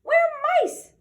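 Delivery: very high, loud squeaky chorus; squeaking mice. Acted. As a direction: squeak it back like frightened mice. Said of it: "they squeaked".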